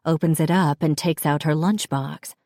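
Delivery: ordinary delivery, nothing marked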